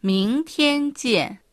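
slow, clear model pronunciation of 明天见; teacher's slow demo of 明天见; slow model phrase. Shown as a demonstration. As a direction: say 明天见 slowly for learners to copy. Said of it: "また明日は、".

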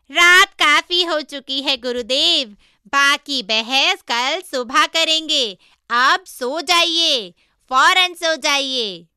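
high, loud, drawn-out character voice; pig disciple, insistent urging. Acted. Pig disciple telling master to sleep at once, insistent. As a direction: call out slowly, stretching the words.

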